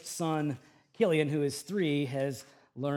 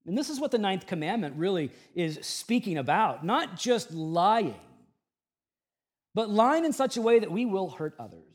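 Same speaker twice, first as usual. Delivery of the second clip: ordinary talk, nothing marked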